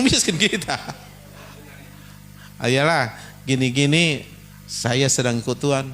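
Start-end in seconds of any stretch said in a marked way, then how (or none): 2.6-5.9 low, easy reassurance; calm humble answer. Shown as a demonstration. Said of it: "Gitu aja bahasanya".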